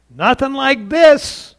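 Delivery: imitating the husband's angry denial; furious retort. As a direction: bristle and throw it back. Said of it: "And he became furious".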